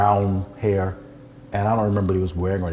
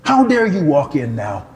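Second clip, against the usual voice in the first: loud, high preaching rebuke; 4 semitones higher, 5 dB louder than usual. Retold or imitated. Preacher rebuking the stranger, loud and indignant. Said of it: "Sorry, I'm preaching".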